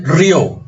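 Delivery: RIÓ as one syllable, stressed on the ó; slow down and lean on the O of "rió"; "rió" with the accent moved onto its last vowel; stress on final O. Shown as a demonstration. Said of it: "el acento pasa a la O".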